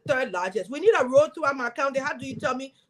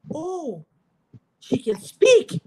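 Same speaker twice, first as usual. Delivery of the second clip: slow, drawn-out mocking surprise; 6 semitones higher, 5 dB louder, much slower than usual. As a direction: stretch it out like a stunned, condescending man. Men shocked she spoke.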